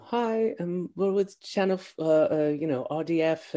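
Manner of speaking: put-on a weak stab at a British accent; imitating a British accent. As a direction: lightly mimic the British TV women in a high British accent.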